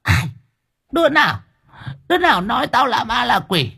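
put-on high, shrieking old hag; furious old woman. Grandmother glaring at her family, shrieking in fury. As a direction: snarl it through clenched teeth. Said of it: "trừng mắt nghiến răng".

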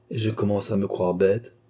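ordinary delivery, nothing marked

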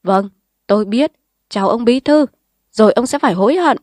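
parting threat. Acted. Khả leaves with a threat.

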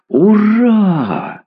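high, jubilant hurrah; cheering cry. A narrator's reading. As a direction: cry it out in celebration. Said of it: "закричал Левинсон".

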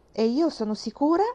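Mary insists with heat; fervent. A narrator's reading. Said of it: "esclamò Mary con fervore". (con fervore, acceso)

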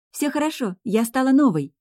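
put-on high, thrilled young girl; delighted. A narrator's reading. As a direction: announce it brimming with delight. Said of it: "с горящими от восторга глазами заявила юная ведьмочка". (восторженно, высоким голосом)

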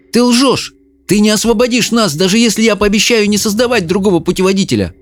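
put-on high, urgent boy's voice; defiant accusation. A narrator's reading. Jared accuses the ogre of lying. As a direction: throw the accusation at him.

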